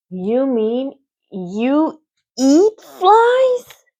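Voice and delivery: high, slow, stretched-out horrified question; a shocked little pig gasping. Acted. Wilbur gasps at Charlotte, horrified and slow. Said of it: "gasped Wilbur".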